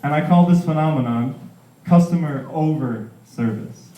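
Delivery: ordinary talk, nothing marked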